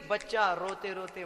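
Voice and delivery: ordinary talk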